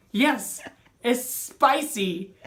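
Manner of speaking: loud, high reenacted complaint, drawn out; pained exclamation. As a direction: exclaim it slowly as if the sting just hit. Retold pained answer after zap.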